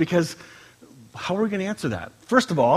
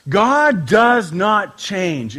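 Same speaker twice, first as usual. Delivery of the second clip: each word spaced and weighted; slow emphasis; 3 dB louder, much slower than usual. Acted. Pastor giving the first foundation, slow and weighty. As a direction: lay it down slowly.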